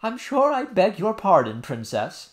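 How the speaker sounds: high, loud stiff offended apology. A narrator's reading. Nurse apologizing to the princess, offended. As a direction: apologize while still offended. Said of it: "in an offended tone".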